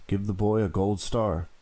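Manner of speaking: ordinary delivery, nothing marked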